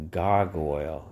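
normal talking voice

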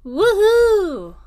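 high loud cheering woohoo; excited whoop. Real, not acted. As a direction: let out a joyful whoop. Cheer after Christmas greeting.

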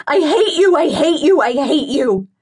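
high and loud, easing off on the last one; furious repeated cry. Acted. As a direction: scream it over and over until it runs out. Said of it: "I cry".

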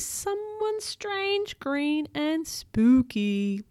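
spooky storyteller reveal; spooky suspense. A narrator's reading. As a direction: stretch each word eerily. Narrator builds spooky suspense.

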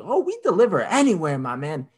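high, breezy salesman voice; cocky salesman impression; mock clueless salesman. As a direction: toss it off like a careless clerk. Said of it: "He's like".

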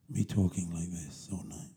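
slow, soft and low; low late-night tone. Shown as a demonstration. Podcaster late at night, slow and soft for listeners. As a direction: drag it out quietly, sleepily. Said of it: "I'm having to talk in a low tone".

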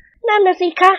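high, firm woman's voice; a wife set on catching her husband; high-voiced wife, resolute and grim; determined young wife. A narrator's reading. Two wives hunt husbands.

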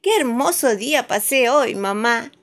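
put-on happy little girl. A narrator's reading. Happy child to mother.

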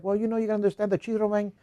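mock Dominican barber's accent; heavy Dominican accent. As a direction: imitate a Dominican barber talking fast. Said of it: "he's very heavy Dominican accent".

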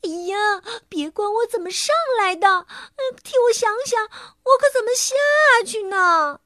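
high, whiny fretting plea; high-pitched worried complaint; fretful young elephant. Acted. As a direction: fret aloud, stretching the ending. Stuck Wilbur pleads from the tree.

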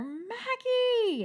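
high, drawn-out mock cry of a little girl; child calling out. Little sister running down the hallway, imitated high call. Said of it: "And you hear".

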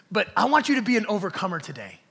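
loud, emphatic preacher's charge; rousing exhortation. Real, not acted. Preacher urging congregation to be overcomers, rousing exhortation. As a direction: press it on the congregation.

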